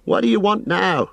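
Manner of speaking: high-pitched, startled innkeeper; indignant cry. Acted. Landlord confronts the ranger who popped up, indignant cry. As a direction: exclaim crossly at an unwelcome arrival. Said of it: "he cried".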